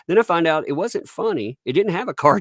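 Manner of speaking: ordinary delivery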